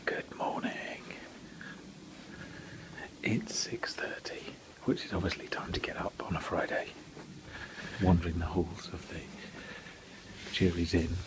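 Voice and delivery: hushed early-morning whisper. Real, not acted. Vlogger greeting viewers at six thirty, whispering. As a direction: whisper it softly, barely voiced.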